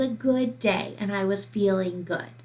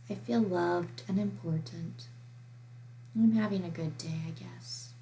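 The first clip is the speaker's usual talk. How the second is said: softer, downcast voice that contradicts the happy words; quiet, sad-sounding answer; 3 semitones lower, 9 dB quieter than usual. Shown as a demonstration. Teacher demonstrating a friend who says she's happy but isn't, soft and downcast. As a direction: say you're fine while sounding unhappy. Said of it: "I was talking kinda quiet".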